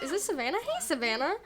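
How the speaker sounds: ordinary delivery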